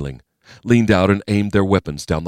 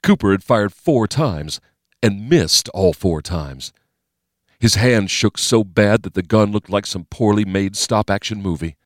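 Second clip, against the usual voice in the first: usual speaking voice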